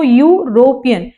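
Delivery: यूरोपियन drawn out slowly, starting with yoo; slow model word. Shown as a demonstration. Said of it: "बोल के देखो".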